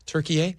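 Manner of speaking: "Turkey, eh" as one name, Tur-kee-ay, rising; "Turkey, eh" said as Türkiye, questioning; international pronunciation. Shown as a demonstration. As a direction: echo "Turkey, eh" with an added "ay" syllable. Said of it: "not Turkey. They're saying Turkey, eh".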